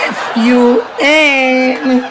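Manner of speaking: F U N stretched out slowly, letter by letter; playfully long-held F U N; drawn-out spelling. Acted. Spells out fun for effect.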